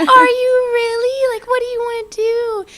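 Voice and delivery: high, loud, tearful imitation of mom; voicing her mom crying. Telling daughter's plan, mom imitated crying high. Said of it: "And she started crying".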